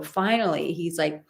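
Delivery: ordinary delivery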